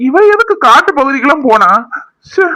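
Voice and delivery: high, loud worried exclamation; anxious outcry. Acted. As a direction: cry it out in relieved alarm. Worried husband scolds absent wife. (பதற்றமான கூச்சல்)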